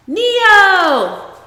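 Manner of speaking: loud, high shout calling him back; yelling his name. Acted. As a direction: yell after the child leaving the room. Mother calling child back.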